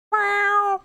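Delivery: high, cute meow; playful kitty meow; cat meow. Pretend. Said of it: "I am the bush kitty".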